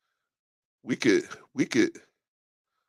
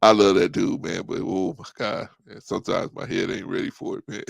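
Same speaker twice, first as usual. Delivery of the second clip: normal talking voice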